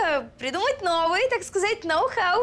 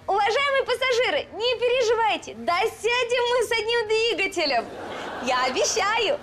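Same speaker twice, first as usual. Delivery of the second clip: выше и громче, как стюардесса объявляет; бодро успокоить пассажиров; громкое объявление; 5 semitones higher, 3 dB louder than usual. Acted. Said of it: "Это обозначает". (громкое объявление стюардессы)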